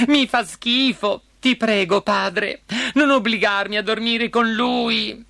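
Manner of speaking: high, whining princess voice; tearful pleading. A narrator's reading. Princess begging father not to force her, tearfully. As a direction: beg father through acted tears. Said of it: "mormorò fra le lacrime".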